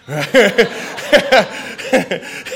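loud, high delighted laugh; a big real laugh bursting out; burst of laughter. Real, not acted. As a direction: crack up out loud with joy. Excited laughing over retold news.

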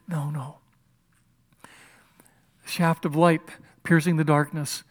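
usual speaking voice

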